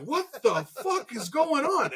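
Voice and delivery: high, slow, drawn-out bewildered cry; baffled slow question; confused disbelief. Retold or imitated. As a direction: stretch it out like you can't believe your eyes. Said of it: "I'm like".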